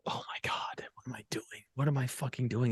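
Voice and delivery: hushed inner panic, retold; whispered self-doubt. Dad questioning himself during the movie, anxious whisper. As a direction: whisper it to yourself like a worried thought.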